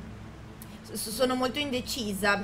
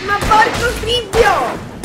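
loud, slow, high exasperated oath; furious gamer swearing; frustrated curse; 11 semitones higher, 14 dB louder, much slower than usual. Real, not acted. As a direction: drag the curse out in anger. Angry after dying.

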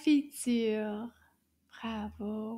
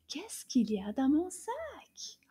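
whisper; 3 semitones higher than usual. Pretend. Puppet whispers to teacher.

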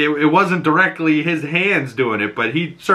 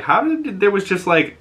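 surprised; 8 semitones higher than usual. Retold or imitated. Own disbelief retold at scene.

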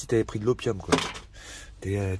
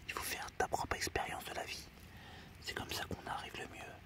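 hushed, secretive whisper of advice; soft mock-sage whisper; whispered wisdom; 14 dB quieter than usual. Whispered life lesson to camera.

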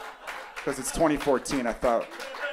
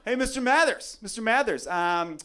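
higher, teasing taunt; a coworker ribbing, voice pitched up; mocking coworker; 7 semitones higher than usual. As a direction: needle the man at lunch. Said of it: "Just like".

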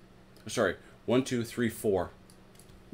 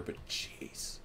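whispered; 17 dB quieter than usual. Real, not acted. Exasperated aside.

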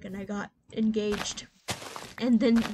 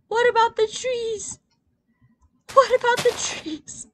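much higher and louder; excited question; 13 semitones higher, 10 dB louder than usual. Real, not acted. Asking friend about the slime trees, excited call.

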